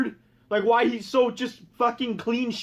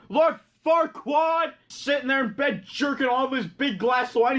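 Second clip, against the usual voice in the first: ordinary delivery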